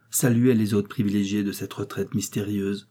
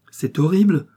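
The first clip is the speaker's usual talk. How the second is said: high, loud shocked exclamation; old lady hearing of the victims, shocked; horrified cry; 8 semitones higher, 7 dB louder than usual. A narrator's reading. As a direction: cry out in horror. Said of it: "s'écria madame Pascal".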